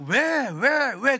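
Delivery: loud, high repeated "why"; mock a grumbler demanding why; complaining. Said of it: "그 원망하는 거예요".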